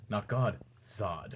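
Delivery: low, quiet villain correction; General Zod. Acted. Villain correcting the kneeling president, low and quiet. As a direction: drop it low and soft on the name. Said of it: "He goes".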